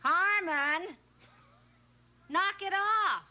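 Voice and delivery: shouting like a fishwife; loud, high, brawling yell; loud gossiping washerwoman. Acted. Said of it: "You sound like a Toscano fishwife".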